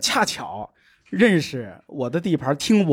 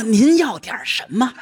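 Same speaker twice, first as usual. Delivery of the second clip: eager shop clerk; 5 semitones higher than usual. Retold or imitated. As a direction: lean in like a pushy pharmacist. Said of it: "说".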